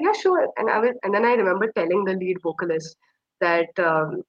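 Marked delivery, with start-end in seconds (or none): 0.0-0.5 high-pitched retold yes; light, lifted voice, readily saying yes; eager agreement. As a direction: imitate someone happily agreeing. Band agrees to photo, retold.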